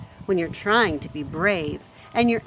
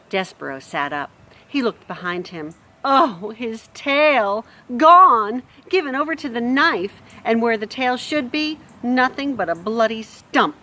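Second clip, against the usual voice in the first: expressive storybook reading; lively read-aloud voice; story narration; 4 semitones higher, 7 dB louder than usual. A narrator's reading. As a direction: read it like a bedtime chapter. Narration builds to shock.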